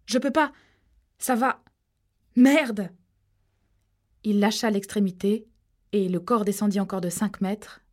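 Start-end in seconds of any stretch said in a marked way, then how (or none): 0.1-2.9 high, loud straining cries; strained shout. Acted. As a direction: yell it while losing grip. Harold struggling with falling rope. (Harold crie en retenant la corde, affolé)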